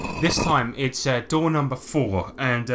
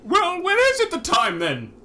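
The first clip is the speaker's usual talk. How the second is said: cheeky creature voice. Acted. Owen snapping back at Grimald, high and squeaky.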